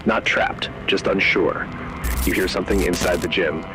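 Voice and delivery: ordinary delivery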